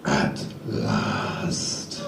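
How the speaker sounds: low, slow, menacing "At last"; deep mock-ominous reply. Online match replying in a low sinister voice. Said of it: "It was just two words".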